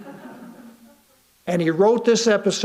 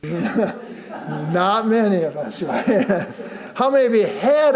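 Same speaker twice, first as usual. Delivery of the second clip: laughing aside. Real, not acted. Joking about teenagers with congregation.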